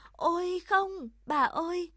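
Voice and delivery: put-on child's high, loud cry of protest; a startled, high exclamation. A narrator's reading. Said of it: "Cô bé kêu lên".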